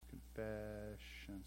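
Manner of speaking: very slow, soft drawn-out word; absent-minded sounding-out. Real, not acted. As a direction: murmur it slowly while writing. Saying word while writing it.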